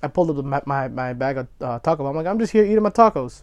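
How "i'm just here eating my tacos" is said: raised cheerful voice; bright and loud. Retold or imitated. Quoting himself to the cop.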